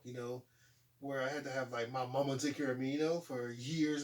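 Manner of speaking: ordinary delivery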